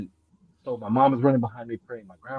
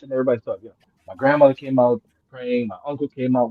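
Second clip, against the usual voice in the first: ordinary delivery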